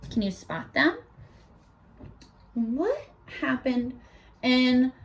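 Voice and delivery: ordinary delivery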